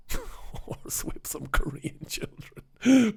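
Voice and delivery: slow, laughing whisper; mock-sinister whisper. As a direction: breathe it out like a guilty secret, slowly.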